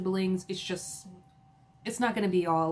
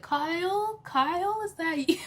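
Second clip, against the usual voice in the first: high, breathy mock Kathy calling out; a much higher, whispery take on Kathy; imitating Kathy; 10 semitones higher than usual. Mimicking Kathy teasing Kyle, high and whispery. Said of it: "she does an impression of Kathy".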